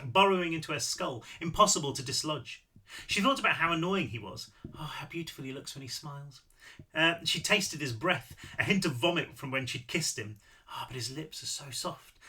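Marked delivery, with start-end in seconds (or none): 4.8-6.4 soft, dreamy inner voice; softly lovestruck; besotted thought. A narrator's reading. Besotted inner thought amid narration. 10.7-12.2 soft, lovestruck inner voice; dreamy and hushed; besotted thought. A narrator's reading. Besotted inner thought amid narration.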